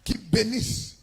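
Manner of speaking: loud, high-pitched exhortation; full-force preaching. Acted. Preacher urging listeners to be the family's solution, forceful preaching. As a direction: preach it out loudly to the whole congregation.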